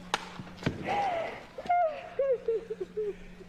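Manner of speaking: very high squealing laugh; high delighted shriek of laughter. Real, not acted. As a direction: burst into a high helpless laugh. Overwhelmed by the scene's timing.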